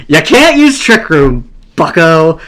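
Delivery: crowing with glee; triumphant taunt. Real, not acted. Taunts opponent, repeating it triumphantly.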